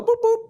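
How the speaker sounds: high mock computer boops; computer beeps. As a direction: beep like a processing brain. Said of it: "Their brains go".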